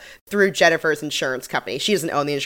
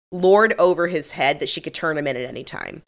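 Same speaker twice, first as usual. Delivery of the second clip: ordinary delivery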